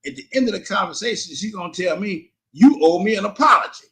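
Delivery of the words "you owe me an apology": loud, insistent mimicked demand; retold angry demand. Ex-wife demanding an apology at call's end, insistent. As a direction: come down hard and loud, imitating her.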